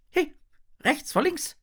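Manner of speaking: high-pitched small fish voice; little fish calling out. A narrator's reading. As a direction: call it out sharply as a tiny fish. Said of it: "ruft ein kleiner Fisch". (hoher Ruf eines kleinen Fisches)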